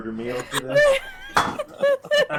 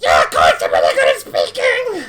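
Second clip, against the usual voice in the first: strange bird voice; 7 semitones lower, 3 dB louder than usual. Acted. GM voices axe beak.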